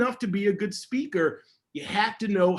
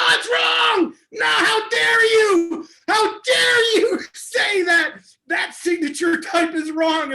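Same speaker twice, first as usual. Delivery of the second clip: much higher, much louder mock-outraged shout, slowed on "how dare you"; manic outburst; 12 semitones higher, 7 dB louder, much slower than usual. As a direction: blow up at the student in hammed-up fury, half laughing. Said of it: "all manic and I go".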